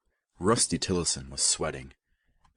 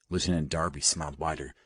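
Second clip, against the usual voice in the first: ordinary delivery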